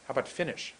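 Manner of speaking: ordinary talk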